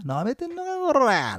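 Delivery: high, menacing thug threat; delinquent tough talk. Retold or imitated. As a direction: threaten like a street punk. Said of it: "なんていうね". (ヤンキー風の脅し)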